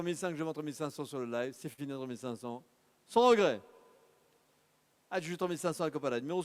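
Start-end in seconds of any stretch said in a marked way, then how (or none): 3.1-3.6 loud, punchy closing call; loud final auction call. Real, not acted. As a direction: bang it out like the gavel falling.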